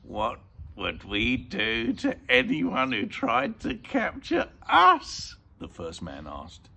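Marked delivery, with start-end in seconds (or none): 0.0-5.4 slow villain voice climbing high at the end; storybook crook posing a threat; menacing thief. A narrator's reading. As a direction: draw out the threat, rising to a peak. Crook poses a menacing question.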